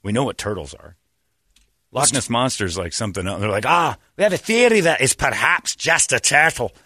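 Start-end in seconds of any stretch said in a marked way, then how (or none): 3.7-6.7 higher-pitched put-on Scottish accent; hammed-up Scottish theorist; mock Scottish believer. Scots believer explaining Nessie away, mock Scottish accent.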